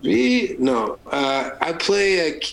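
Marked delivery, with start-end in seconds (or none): 0.0-0.5 lightly put-on French accent; high mock French sounds; fake French. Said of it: "that's not even French".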